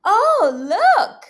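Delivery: high, loud "oh, look"; excited. Acted. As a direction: burst out in wonder at the egg. Egg about to hatch.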